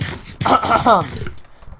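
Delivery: loud, forceful throat clear. Real, not acted. Clearing a failing voice.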